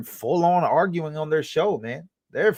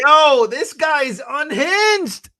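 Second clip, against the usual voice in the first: loud, high, drawn-out exclamation; stretched high holler of amazement; amazed outburst; 7 dB louder, much slower than usual. Real, not acted. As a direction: cry out in drawn-out disbelief. Host reacts to clip's outburst.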